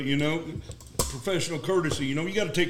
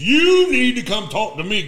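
loud, slow and higher demand; 1 mock demand; 7 semitones higher, 9 dB louder, slower than usual. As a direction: bark it down the phone. Retold gunny's demand.

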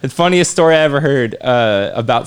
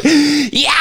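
mock fade-out yeah; 11 semitones higher than usual. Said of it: "it was like".

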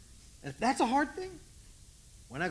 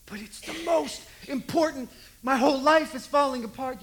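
louder, higher mock teenage wail; dramatic teen wail; 6 semitones higher, 10 dB louder than usual. Teen insists her life is over, mock wailing. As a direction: ham up a teenager's melodrama.

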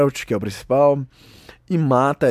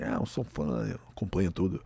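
mock show-off whisper; 4 semitones lower, 10 dB quieter than usual. Mocking himself posing as fan.

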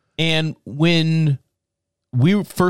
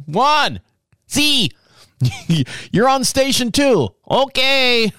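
hammed-up manager assigning a station; mock kitchen boss; 10 semitones higher, slower than usual. Boss to a dishwasher cook, high mock call.

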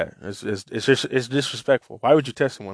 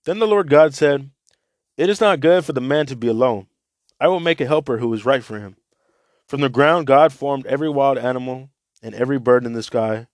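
a raised, steady read-aloud voice; scripture reading to listeners; Bible reading; 4 semitones higher than usual. Acted. Reads Bible passage aloud.

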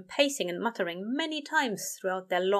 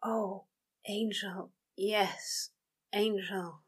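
soft; 5 dB quieter, much slower than usual. A narrator's reading. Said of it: "a soft string of".

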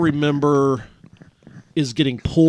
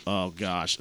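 hushed dismay; 7 semitones lower, 11 dB quieter than usual. Retold or imitated. Sees blood everywhere.

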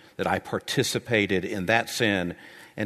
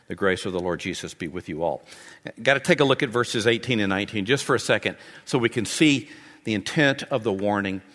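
ordinary talk, nothing marked

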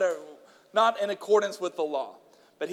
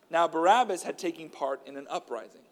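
earnest, raised reading voice; scripture reading; 5 semitones higher than usual. A narrator's reading. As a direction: read the Gospel aloud to the church.

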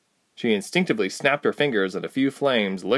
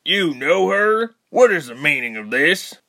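indignant soldier; 7 semitones higher, 5 dB louder than usual. Acted.

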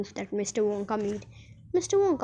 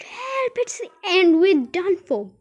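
high cry for help, turning loud; panicked shout; 8 semitones higher, 7 dB louder than usual. A narrator's reading. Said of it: "Grandpa just shouted".

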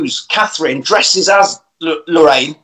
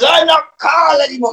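shouty; 9 semitones higher than usual. Retold or imitated. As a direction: yell it out. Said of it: "He gets really, really shouty".

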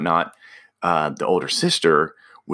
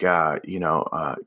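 usual speaking voice

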